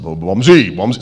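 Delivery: loud call of a nickname. Retold or imitated. Boss phones his photographer by nickname, calling out. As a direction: hail him by nickname, loudly. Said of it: "He calls me and said".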